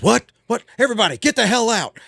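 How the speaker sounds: high-pitched mock furious order. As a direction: act out a star angrily clearing the room. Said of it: "And he got all mad".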